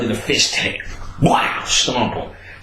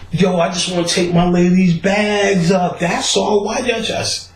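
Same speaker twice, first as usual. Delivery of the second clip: raised, whining protest; whiny Ellis voice; 9 semitones higher than usual. Retold or imitated. Ellis whining to the guard about carrying the bags, high and aggrieved. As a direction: sound like a put-upon guy whining.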